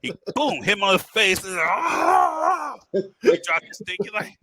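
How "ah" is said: long, high, loud "aah" scream; imitated scream. Instructor stung in the face, imitated scream. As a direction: mimic a man screaming from a sting.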